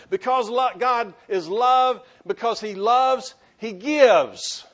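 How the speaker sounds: loud, high, slow refrain; preaching at full force. Acted. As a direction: drive the refrain home from the pulpit.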